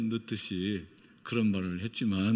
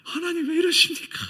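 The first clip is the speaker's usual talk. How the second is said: high, loud sobbing plea; tearful cry of why; crying out to God; 7 dB louder than usual. Retold or imitated. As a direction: cry it out in anguish, voice breaking. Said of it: "얼마나 눈물이 솟구쳐 나오든지".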